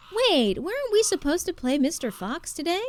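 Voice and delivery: put-on high, eager child's question; kid asking. Coach imitates kids remembering the game, high and eager. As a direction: ask like a kid who just remembered. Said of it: "they go".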